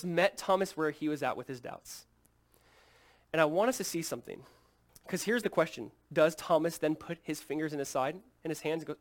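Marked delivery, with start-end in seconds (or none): none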